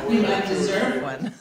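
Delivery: teasing through a laugh; laughing tease. Real, not acted. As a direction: joke back while still laughing. Teasing reply after laughing.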